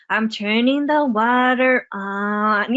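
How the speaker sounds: "I'm turning the water on" in slow, stretched-out modeled tune; copied singsong intonation. Retold or imitated. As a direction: imitate a child echoing it, drawing out "water" and "on". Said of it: "say it with the same intonation".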